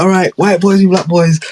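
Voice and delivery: a light mock of the other team calling it; high, cheeky banter voice calling teams. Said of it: "they would call it".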